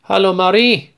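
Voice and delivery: high, friendly greeting from behind. A narrator's reading. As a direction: greet her kindly and brightly. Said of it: "sagt plötzlich eine freundliche Stimme". (freundliche Stimme, deutlich höher)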